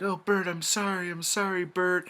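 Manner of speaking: put-on high, pleading girly voice apologising; girlfriend pleading. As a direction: play the sorry girlfriend, pitched well up. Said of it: "And she's like".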